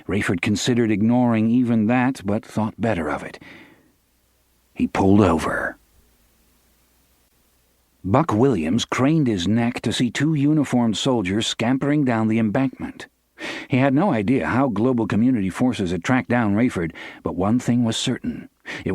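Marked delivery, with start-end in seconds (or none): none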